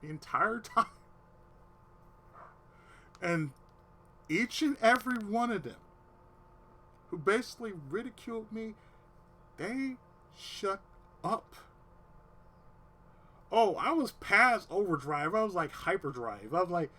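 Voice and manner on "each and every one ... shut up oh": usual speaking voice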